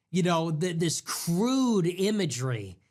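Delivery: normal talking voice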